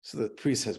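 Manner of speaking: normal talking voice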